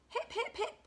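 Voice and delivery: high, short hooted cheers; happy hooting. A narrator's reading. Duckbills celebrating Drip's victory, high happy hoots. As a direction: hoot it in jubilant bursts. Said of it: "and they hooted".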